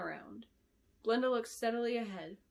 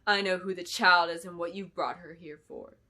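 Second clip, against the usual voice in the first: menacing villain; 2 semitones lower, 6 dB louder than usual. A narrator's reading. Clarice's menacing reveal.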